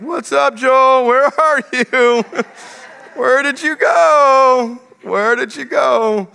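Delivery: high, slow teasing sing-song, with a laugh; sing-song taunting. Retold or imitated. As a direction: taunt the hidden child playfully. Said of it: "And I'm just like".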